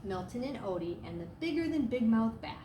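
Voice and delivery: plain reading voice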